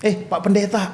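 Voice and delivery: teasing. Retold or imitated. Patient addressing a visiting friend as pastor, teasing. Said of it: "gitu, saya ngeledek-ngeledek".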